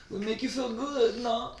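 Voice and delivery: high, silly European come-on; high-pitched fake European seducer; mock European accent. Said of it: "we made fun of Europeans".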